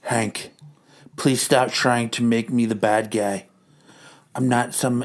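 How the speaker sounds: low, soft put-on wife voice; a weary wife handling everything. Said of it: "They continue on".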